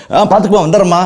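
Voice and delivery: high, loud, rushed brush-off; preacher imitates someone hurrying past a friend, high and loud; hurried brush-off. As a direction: toss it off while rushing past. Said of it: "அவசரமா சொல்லுவோம்".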